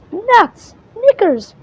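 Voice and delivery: high, loud exasperated outbursts; frustrated cursing. Acted. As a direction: spit out mild curses in annoyance. Winnie's frustrated exclamations.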